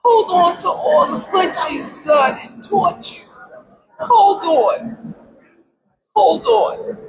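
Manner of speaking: high, crying words to her daughter; tearful plea. Real, not acted. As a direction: beg her to hold on through tears. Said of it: "I said".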